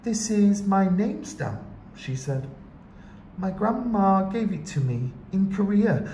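put-on girl voice. A narrator's reading. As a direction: voice a shy young girl.

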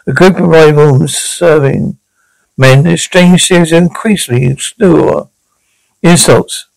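ordinary talk

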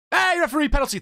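high call to the ref; shouting at the referee. Real, not acted. Gamer yelling at the on-screen referee. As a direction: yell for the referee.

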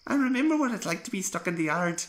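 put-on an older engine explaining himself; kindly old engine. A narrator's reading. Peter Sam explaining to the young engine why he said yes, gentle.

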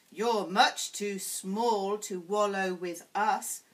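a lightly voiced scornful hippo herd; huffing hippos. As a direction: huff it out like a big bored hippo. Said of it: "huffed the hippos".

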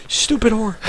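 ordinary delivery

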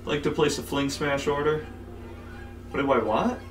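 ordinary delivery

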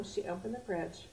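ordinary delivery

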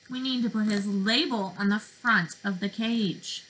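put-on lively, sing-song picture-book voice for little kids; reading to children. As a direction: read it like circle time, drawing them in.

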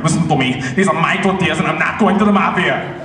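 urgent accented action-hero impression; hammed-up Austrian-accented warning, climbing in pitch; Arnold Schwarzenegger. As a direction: plead it like a panicked action star. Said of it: "Arnold Schwarzenegger".

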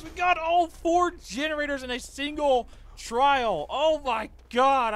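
high, slow, still thrilled; excited. Real, not acted. Gamer telling viewers the challenge is done, still thrilled. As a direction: ride the victory high.

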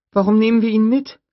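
put-on a curious little girl asking; young girl voice. Lex asks Grant about the raptor, high child voice.

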